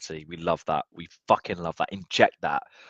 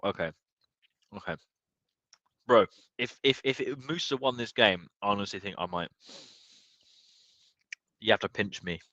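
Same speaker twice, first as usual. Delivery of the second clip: normal talking voice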